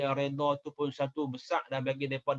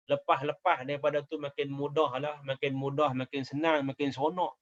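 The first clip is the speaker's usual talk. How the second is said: ordinary talk, nothing marked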